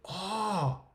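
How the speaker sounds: high laughing oh hey; delighted surprise. Real, not acted. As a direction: burst out in happy surprise. Finds the calendar symbol.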